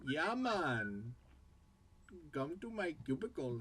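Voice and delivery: comic Jamaican turning Indian; Jamaican into Indian accent. Shown as a demonstration. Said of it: "Jamaican into Indian".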